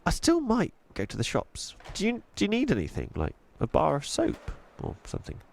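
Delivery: put-on high, curious little-girl voice; young girl Chloe. Chloe offers help.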